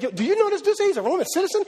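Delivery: high, panicky "yo"; a spooked guard blurting the news; scared. Acted. The scared tribune tells his men, high and panicky. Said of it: "he gets scared".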